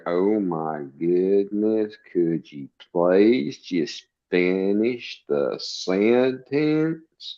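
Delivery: imitating slow Alabama talk, every word stretched; mock slow Southern drawl. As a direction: drag each word out very slowly. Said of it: "when they don't talk much or fast".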